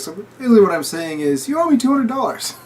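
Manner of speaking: fast, high, loud smug voice; mock German punchline. German explains the bounty to the crowd, fast high mock voice.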